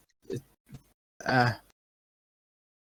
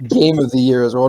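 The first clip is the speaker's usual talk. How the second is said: ordinary talk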